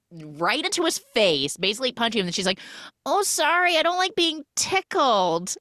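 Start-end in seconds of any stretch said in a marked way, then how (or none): 3.1-5.6 fake innocent apology. She elbows her boyfriend, then a high fake-sweet apology. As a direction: play it falsely innocent.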